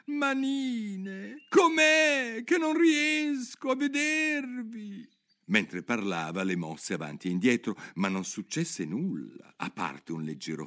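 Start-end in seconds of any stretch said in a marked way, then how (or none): 0.0-5.1 high, slow, crying Alice voice; a little girl calling her far-off hands, on the verge of tears; Alice lost. Acted. (bambina che chiama piagnucolando)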